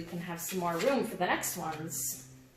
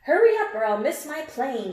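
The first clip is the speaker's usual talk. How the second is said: urgent call; 6 semitones higher, 7 dB louder than usual. Acted. Passenger urging the taxi driver.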